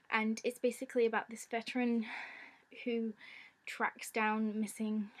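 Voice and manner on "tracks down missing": normal talking voice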